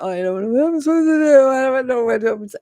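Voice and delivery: panicked babbling. Acted. Reader voicing the client overwhelmed by a soulmate's arrival, panicked. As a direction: babble it out like someone falling apart. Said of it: "you're gonna fall apart".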